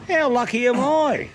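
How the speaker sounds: high, slow, gushing fan voice; mock starstruck squeal. To camera about the famous bloke, a high mock-thrilled squeal. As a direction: hams up being thrilled, drawing it out.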